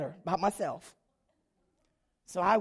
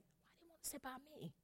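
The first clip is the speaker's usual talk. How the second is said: much softer, quick, higher; suspicious whisper-like aside; 4 semitones higher, 25 dB quieter, faster than usual. Retold or imitated. Her own inner worry at the meeting, hushed. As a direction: hush it nervously to yourself. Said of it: "I'm like".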